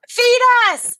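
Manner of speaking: high, loud demanding cry; screaming geese. A narrator's reading. Geese demanding food from the rich queen, high loud scream. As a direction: shriek it like hungry geese. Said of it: "they screamed".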